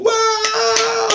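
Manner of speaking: high squealing wow from the crowd; excited kids. Retold or imitated. Kids reacting in chorus.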